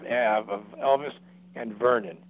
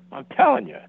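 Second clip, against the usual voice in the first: insistent excitement; 10 semitones higher, 7 dB louder than usual. Retold or imitated. Friend insists spirit is present.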